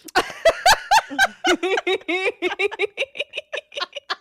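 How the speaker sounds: drawn-out cackle; long high laugh. Real, not acted. Cracking up at the mom bomb joke, long laugh. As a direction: let the laugh roll on.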